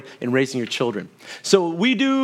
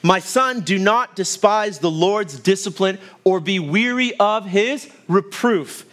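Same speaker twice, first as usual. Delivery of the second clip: raised, slow, solemn scripture reading; 4 semitones higher than usual. Acted. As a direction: read the verse like a sacred proclamation. Reading scripture verses aloud.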